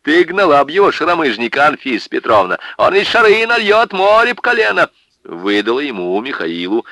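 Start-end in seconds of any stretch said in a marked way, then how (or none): 0.0-4.9 high, loud scolding shout; angry yelling. Acted. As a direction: yell at the drunk husband. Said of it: "с порога наорала на мужа".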